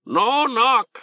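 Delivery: high, insistent bird squawk; a pet bird insisting loudly; talking bird. Acted. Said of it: "Orb insisted".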